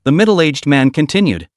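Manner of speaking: plain reading voice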